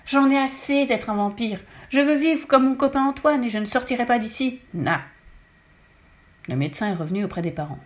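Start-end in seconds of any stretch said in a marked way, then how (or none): 0.1-5.0 defiant outburst. A narrator's reading. Lou refusing to leave the toilet, defiant outburst. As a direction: dig in your heels and refuse.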